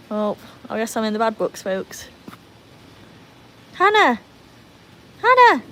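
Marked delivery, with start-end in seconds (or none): 3.8-5.6 loud, high, short calls; calling the dog. Real, not acted. Owner calling dog Hannah, loud high call.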